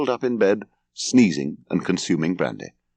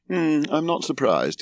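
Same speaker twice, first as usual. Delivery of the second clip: slow, higher-pitched drowsy drone; sleepy unconcern; 7 semitones higher than usual. A narrator's reading. Son lying down again despite the fire, drowsily unconcerned. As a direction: drawl it as if dozing off. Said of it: "he said, lying down again".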